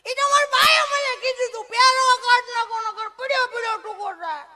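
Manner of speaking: put-on very high, very loud laughing child; hammed-up child shouting through laughter; laughing kid shout. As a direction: blurt it out like a giggling schoolboy. Boy explains, laughing shouting.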